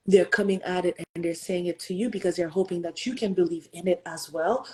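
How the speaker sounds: ordinary delivery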